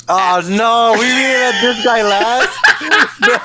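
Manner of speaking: high-pitched, incredulous laughing cry; laughing disbelief. Real, not acted. Friends rib each other over rankings.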